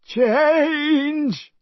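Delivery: very high shouted magic word; cried spell. A narrator's reading. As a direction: cast the spell with a cry. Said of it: "and cried".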